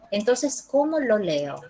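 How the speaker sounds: normal talking voice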